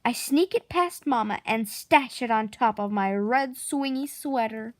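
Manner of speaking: usual speaking voice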